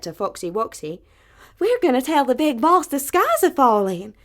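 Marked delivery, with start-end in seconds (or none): 1.6-4.1 high, loud, breathless hen; alarmed little hen. Acted. Little hen telling the rooster the sky falls, high and loud. Birds announce the falling sky.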